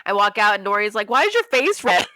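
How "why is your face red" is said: acted puzzled question.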